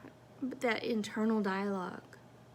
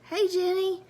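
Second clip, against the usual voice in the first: high, louder hello; cheery greeting; 8 semitones higher, 5 dB louder than usual. Retold or imitated. Greeting coworker Jenny on arrival, cheery high call.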